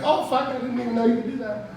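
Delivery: high, fast, amazed blurt; surprised patient. Retold or imitated. Patient stunned when the chiropractor snaps his back, high and fast. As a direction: caught off guard by the crack. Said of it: "You're like".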